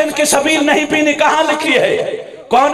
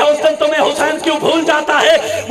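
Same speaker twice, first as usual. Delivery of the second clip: usual speaking voice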